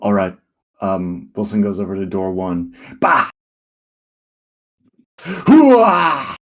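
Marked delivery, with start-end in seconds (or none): 3.0-6.4 loud battle cry, high and bellowed; loud gung-ho soldier. Acted. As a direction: roar it like a charging soldier. Wilson kicks the door, battle cry.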